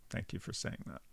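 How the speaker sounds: whispered. Real, not acted. Unvoiced thanks after concession.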